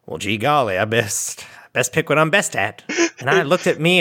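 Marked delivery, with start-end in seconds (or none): 0.1-2.8 put-on gee golly, play the simple farm boy; folksy young [name]. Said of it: "And I said".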